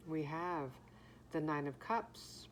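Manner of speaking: talking around a breath mint; breath mint in mouth. Real, not acted. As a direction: speak with a mint in your mouth. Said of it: "I have a breath mint in my mouth".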